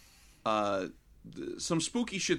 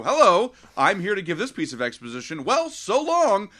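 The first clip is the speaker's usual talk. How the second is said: mock cheery bit character; 4 semitones higher, 7 dB louder than usual. B characters delivering exposition.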